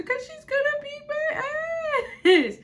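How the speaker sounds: slow, high, loud whiny wail; mock crying wail. Mimicking the classmate crying to others, slow high mock wail. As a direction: drag it out in a blubbering cry. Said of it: "I remember hearing her say".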